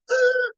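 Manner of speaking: high, sharp gasp of delight; excited gasp. Retold or imitated. Speaker hearing Carly owns a salon, a high delighted gasp. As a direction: gasp as if the news thrills you.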